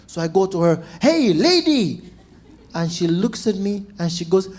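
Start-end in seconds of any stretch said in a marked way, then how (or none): 1.0-2.0 loud, higher, drawn-out angry holler; acted-out angry shout across a street; angry call. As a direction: yell angrily to stop a stranger. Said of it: "only energy you have is just anger".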